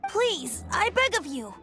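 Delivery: high-pitched begging; small, imploring plea; pleading. Acted. Bard begs the refusing sister.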